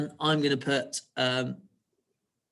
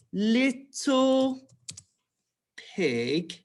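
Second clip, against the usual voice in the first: dictation; 9 semitones higher, much slower than usual. Shown as a demonstration. Dictating words while writing them out.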